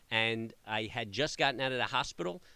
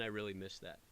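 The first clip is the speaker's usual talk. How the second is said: usual speaking voice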